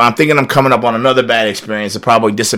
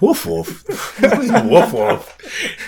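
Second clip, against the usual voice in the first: high, baffled "woof woof?" with a laugh; confused guest echoing the waiters, incredulous; 10 semitones higher, much slower than usual. Retold or imitated. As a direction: repeat it back in disbelief, laughing.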